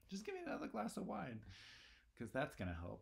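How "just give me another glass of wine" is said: high, fast mocking voice; mock Macbeth. Teacher mocks Macbeth asking for wine, high and fast. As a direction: send up his flimsy excuse.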